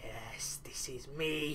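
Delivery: whispered self-satisfied; unvoiced gloating whisper; smug whisper. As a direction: breathe it out smugly to yourself. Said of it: "so smug".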